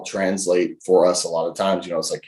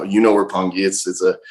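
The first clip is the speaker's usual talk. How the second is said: ordinary talk, nothing marked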